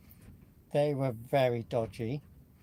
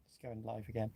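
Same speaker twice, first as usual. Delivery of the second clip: whisper; 4 semitones lower, 11 dB quieter than usual. Real, not acted. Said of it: "whispered aside".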